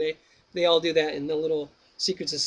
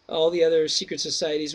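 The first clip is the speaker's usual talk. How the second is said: plain reading voice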